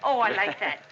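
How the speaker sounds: usual speaking voice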